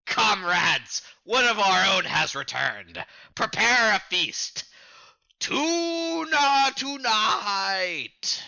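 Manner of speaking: booming pirate boss proclamation; rally the crew, stretching out the last words; Arlong. Acted. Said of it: "you play Arlong".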